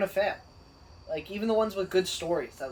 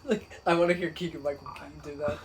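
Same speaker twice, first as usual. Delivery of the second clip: ordinary talk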